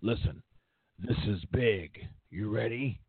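weighty, low pitch to an employee; a hint of a big boss imitation; Vince. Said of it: "And Vince says".

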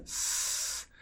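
quiet voiceless hiss; soft hiss. Shown as a demonstration. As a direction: breathe out a thin s. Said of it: "Solamente es aire". (siseo suave sin voz)

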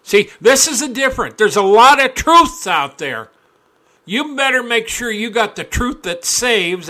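fiery preaching. Real, not acted. Preaching at full force.

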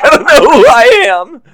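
very high, loud laughing wail; anxious Rex. Retold or imitated. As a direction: squeal it like a panicked dinosaur. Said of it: "He's like".

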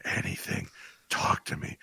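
soft, voiceless ghostly whisper; imitated whispering ghost. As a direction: coax in a spirit's whisper. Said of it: "the ghost is like".

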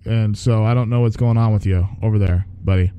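usual speaking voice

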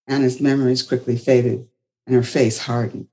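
usual speaking voice

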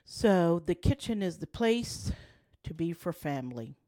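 normal talking voice

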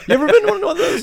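high, loud, laughing as he asks; giggling through the question. Real, not acted. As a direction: laugh it out while asking. Asking, laughing.

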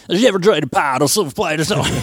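high, loud, fast American mangling French; American speaking French. Retold or imitated. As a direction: ham up a clueless American trying French. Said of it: "impression of Americans speaking French".

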